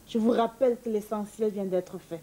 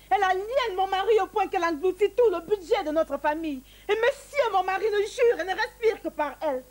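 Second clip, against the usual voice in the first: higher and higher, near tears; rising outrage; 7 semitones higher than usual. Acted. Wife protesting her husband's obsession, pitch climbing near tears. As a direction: whip herself up toward a sob.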